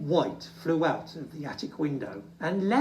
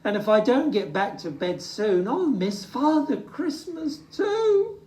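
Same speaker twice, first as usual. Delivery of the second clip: tearful; 8 semitones higher than usual. Acted. Little Bear tells Hoot he will miss Father Christmas, tearful. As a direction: whimper it out near tears. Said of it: "he sniffed".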